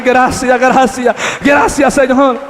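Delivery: loud joyful cry of thanks; shouting thanks. Retold or imitated. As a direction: shout your thanks to God. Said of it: "yo empecé a gritar". (gritando gracias con júbilo)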